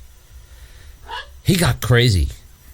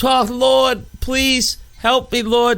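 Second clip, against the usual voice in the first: high, slow wail for help; hammed-up begging to heaven; pleading prayer; 12 semitones higher, much slower than usual. Archie begging God to save him, pleading. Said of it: "he was praying to God".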